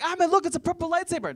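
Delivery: put-on high, loud thrilled child's voice; excited kid. Little brother spotting a purple lightsaber in the aisle, squealing with delight. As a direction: squeal it like a kid finding a toy. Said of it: "My little brother's like".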